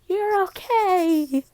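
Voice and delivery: high, loud, stretched-out cheer; excited relief. Acted. Classmates greet Deku back from hospital, loudly thrilled. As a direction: shout it joyfully, drawing out each word.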